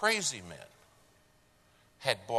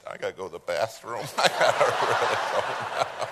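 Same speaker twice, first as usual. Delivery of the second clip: desperate laughing; 6 dB louder, faster than usual. Retold or imitated. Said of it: "the guy in the back seat said".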